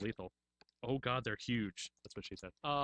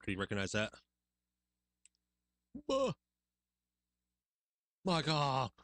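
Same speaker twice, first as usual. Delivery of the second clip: ordinary talk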